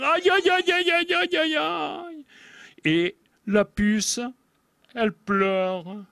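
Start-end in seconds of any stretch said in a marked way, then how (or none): 0.0-2.2 high wailing ouch ouch ouch; cries of pain. Acted. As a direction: wail it out high like a hurt bug. Louse burns its behind.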